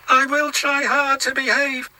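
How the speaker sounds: high, put-on engine voice, sorry for himself; a high little engine voice full of self-pity; James the engine, glum. James alone in the shed, high glum engine voice. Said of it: "said James".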